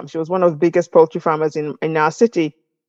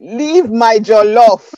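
slow, louder Nigerian accent; 4 semitones higher, 6 dB louder, much slower than usual. Shown as a demonstration. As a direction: lean into a Nigerian accent, slowly. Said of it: "my Nigerian accent will come out now".

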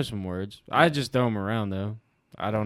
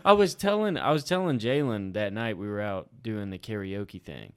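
usual speaking voice

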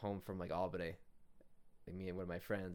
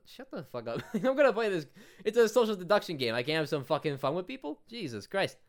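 loud, higher laughing rant; laughing outrage; 10 semitones higher, 10 dB louder than usual. Real, not acted. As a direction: blow up in disbelief, laughing. Ranting, laughing at her rebuke.

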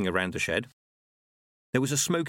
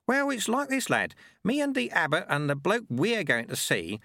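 put-on knowing old sweeper, pitched up; chatty sweeper explaining in a higher voice; Lutze; 7 semitones higher than usual. Sweeper explaining abbot's ways to the lad, knowing.

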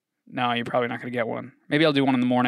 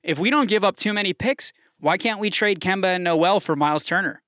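normal talking voice

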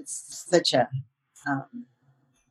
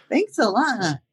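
sarcastic; 6 semitones higher, slower than usual. Retold or imitated. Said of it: "It's like".

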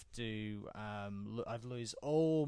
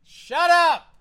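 very high, loud yell at the dog; shouting; 23 dB louder than usual. Real, not acted. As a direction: snap at the barking dog, full volume. Yelling at the dog.

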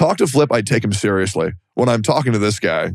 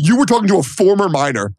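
mock indignation; 7 semitones higher, faster than usual. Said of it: "How dare you talk".

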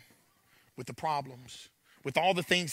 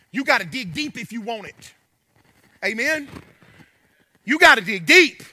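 louder, higher, rising sermon push; preaching; 10 semitones higher, 13 dB louder than usual. Acted. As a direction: build back up to full preaching force. Building back to full preaching.

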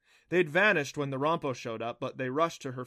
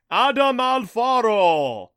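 thunderous slow shout, pitched up; Jakuta booming; 11 semitones higher, 14 dB louder, much slower than usual. A narrator's reading. Thunder god hails Adam by name, booming. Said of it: "Jakuta boomed in a voice like thunder".

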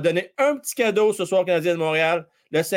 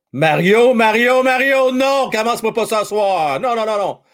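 louder, higher pleading no; loud protest; 5 semitones higher, 6 dB louder than usual. Real, not acted. Streamer stopping Mario in chat, loud protest. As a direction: cut off a viewer's teasing.

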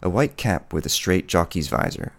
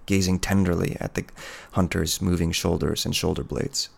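plain reading voice

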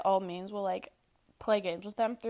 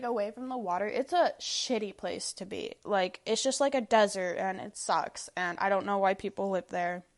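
ordinary delivery

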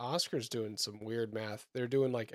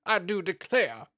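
slow, loud, higher Southern drawl; mock Southern coworker; 5 semitones higher, 5 dB louder than usual. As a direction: declaim it in a theatrical Southern accent. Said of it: "the number of times I hear".

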